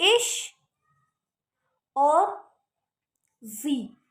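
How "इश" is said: "इश" said high and a bit louder, sh ending; teacher naming the sh stroke; sh sound name. Shown as a demonstration. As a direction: pop out "इश" on a high note. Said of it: "इसको हम बोलेंगे".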